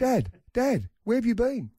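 put-on very high, whiny little-kid voice; child calling out. Found son asking dad where he was, childlike. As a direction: mimic a small boy calling, pitched right up.

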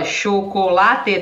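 "chocolate" drawn out slowly, syllable by syllable; even syllables. Shown as a demonstration. Teacher contrasting Portuguese with English stress, "chocolate" in slow equal syllables. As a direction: say "chocolate" in Portuguese, cho-co-la-te evenly spaced. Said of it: "tá tudo com o mesmo som".

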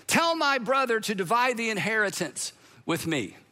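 ordinary talk, nothing marked

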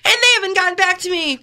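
high, mock-tearful complaint; whiny TV litigant. Litigant complaining about unpaid money, high mock whine. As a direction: plead your case in a hammed-up high whine. Acting out a court show litigant.

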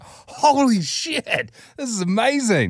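high, drawn-out awe; retold amazement. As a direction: stretch it out, marvelling at the spectacle. Retold amazement at Dad's outburst.

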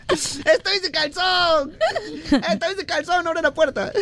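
high squealing cry through laughter; mock panic, laughing. Joking with cohost, mock panicked laughing squeal. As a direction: shriek it like you got caught undressed. Playing caught undressed, laughing.

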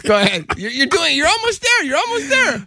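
high excited cheering; encouraging. Real, not acted. Cheering on a French attempt.

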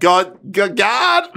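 hammed-up singer's accent; [name] accent. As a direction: test the accent on one word, repeating it. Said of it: "go into the accent".

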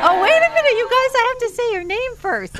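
laughing. Real, not acted. Laughing at her own slip.

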